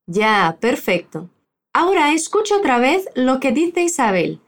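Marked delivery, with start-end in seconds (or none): none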